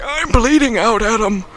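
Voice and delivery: high, strained retold complaint; a hammed-up wounded man's excuse; mock anguished protest. Wounded doctor answers Adam, mock anguished protest. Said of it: "And he's like".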